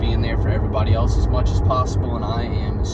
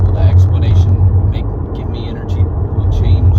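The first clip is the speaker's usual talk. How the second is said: loud, heated retort; imagined argument; 7 dB louder than usual. Retold or imitated. Arguing with his brother in his head, loud and heated. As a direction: snap back loudly and sarcastically.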